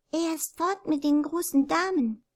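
put-on a small girl babbling it out; babbling little girl. A narrator's reading. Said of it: "plapperte das kleine Mädchen". (kleines Mädchen plappert, viel höher)

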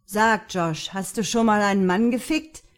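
loud, brash booming question. A narrator's reading. Luke puts an intimate question to drunk Josh, booming. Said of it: "dröhnte Lukes Stimme in seinem Schädel".

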